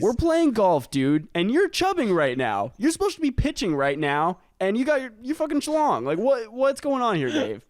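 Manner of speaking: high-pitched, indignant ranting; mock exasperated scolding. Golfer scolding his buddy mid-game, mock exasperated. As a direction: berate your buddy with comic exasperation. Acted scolding of friend Dave.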